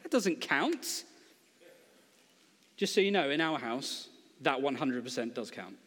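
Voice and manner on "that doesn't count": smug comeback. Retold or imitated. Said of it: "And I say".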